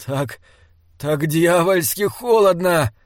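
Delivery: slow, strained cry that climbs in pitch and force; a wounded man shuddering with cold; shivering. A narrator's reading. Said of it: "Он дрожал".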